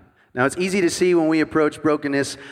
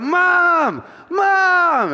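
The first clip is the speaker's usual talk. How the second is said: high, loud scream of Mom; a panicked kid yelling across the lot; 5 dB louder than usual. Retold or imitated. Boy with a broken arm yelling for his mom, high and loud. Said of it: "I'm, like, screaming".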